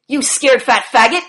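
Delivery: ordinary delivery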